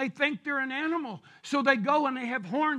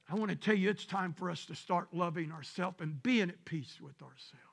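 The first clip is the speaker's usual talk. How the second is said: softer, lower pastoral appeal; tender, consoling; 5 semitones lower, 7 dB quieter than usual. Real, not acted. Preacher urging people to be at peace with themselves, tenderly. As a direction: drop low and soft to reassure them.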